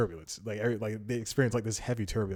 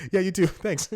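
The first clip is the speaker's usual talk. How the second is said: high, loud, giddy reply; flustered delight; 8 semitones higher, 7 dB louder than usual. Retold or imitated. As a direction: gush a thank-you, laughing.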